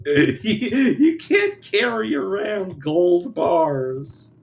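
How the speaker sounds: laughing through the words, slow and loud; can barely get it out for laughing. Real, not acted. Streamer laughing at his own gold bars joke, laughing hard.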